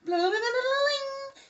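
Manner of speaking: high, slow mock whine of an ex's sweet talk. Reader mocks the ex's love talk with a high whining blah blah. They're playing it like a damn harp.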